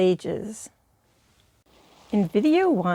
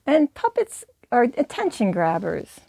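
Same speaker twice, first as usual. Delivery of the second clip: usual speaking voice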